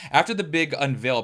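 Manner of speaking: ordinary talk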